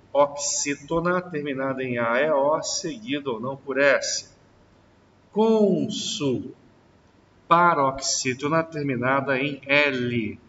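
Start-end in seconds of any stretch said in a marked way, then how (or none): none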